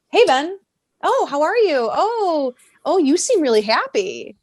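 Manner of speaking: high, sing-song fake friendliness; mock a manipulator cooing at a child; Snoke. Villain pops up to greet a happy boy, mock-friendly. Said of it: "who pops up? Snoke".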